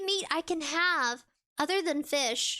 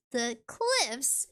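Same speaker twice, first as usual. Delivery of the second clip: ordinary delivery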